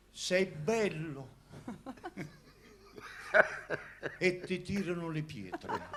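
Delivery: slow, high, mock-grand recitation; theatrical swelling poetry reading. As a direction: swell each line like a pompous poet. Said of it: "volevo recitare una poesia".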